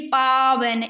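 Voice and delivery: "पावन" slow with long aav; sounded out. Shown as a demonstration. Teacher showing students how to listen, "पावन" stretched. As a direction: draw out "पावन", paa-van. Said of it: "बस इसको बोल के देखना".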